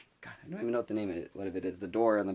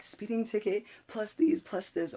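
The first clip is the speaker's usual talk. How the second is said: very high squeaky mock panic; 13 semitones higher, slower than usual. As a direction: squeal it in exaggerated dismay. Retold panic over mounting fines.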